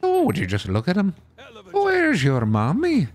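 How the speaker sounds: high, swooping Irish lilt; Irish accent. Shown as a demonstration. Showing friends an Irish accent, sing-song and high. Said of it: "I could do a kinda like Irish".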